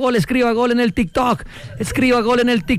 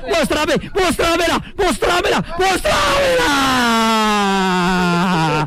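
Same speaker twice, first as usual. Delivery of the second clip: ecstatic shout; 2 semitones higher, much slower than usual. Real, not acted. Commentator begging to see the moon, frantic drawn-out shout. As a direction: stretch the last word like a goal call.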